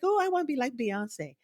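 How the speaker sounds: put-on high, soft childlike voice; dreamy girl. Girl answering who she wants to be, high and soft. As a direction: say it like a starry-eyed kid. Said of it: "They're like".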